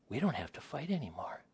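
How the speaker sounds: hushed, whispered mock wonder; soft acted amazement; whispered realisation. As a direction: whisper it as it dawns on you. Couple's dawning realisation, acted.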